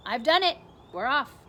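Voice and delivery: loud triumphant shout; proud centipede yelling down through the hole. A narrator's reading. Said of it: "and shouted".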